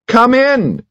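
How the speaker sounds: high, ringing call through the door; cried out. A narrator's reading. Lady Carados calling her secretary in, cried out high. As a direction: call out from the bedroom. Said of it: "cried a voice".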